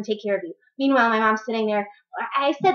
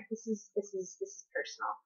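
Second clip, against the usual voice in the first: dropped to a near whisper, softer; hushed confiding; 2 semitones lower, 17 dB quieter than usual. Real, not acted. To her viewers, admitting it's personal, in a hushed near whisper. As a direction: lean in and say it like a secret. Confiding what the rant is about.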